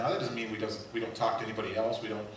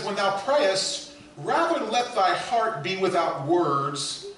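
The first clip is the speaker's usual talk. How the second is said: slow booming quote; 6 semitones higher, 8 dB louder, slower than usual. Acted. Pastor reading Bunyan to his congregation, slow and booming. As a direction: proclaim it slowly and loudly, word by word.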